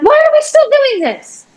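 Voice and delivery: high, exasperated cry to the sky; exasperated outcry. Retold or imitated. Mad in her studio, crying out high to God. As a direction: fling the question up in frustration. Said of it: "And I'm like".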